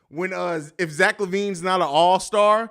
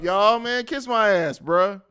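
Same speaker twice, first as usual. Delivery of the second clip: ordinary talk, nothing marked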